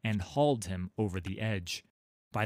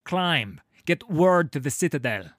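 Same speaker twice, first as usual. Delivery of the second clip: loud urgent order; urgent loud command; 6 semitones higher, 8 dB louder than usual. A narrator's reading. Halla ordering Sigvar to the Citadel, loud command. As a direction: drive him off up the wall.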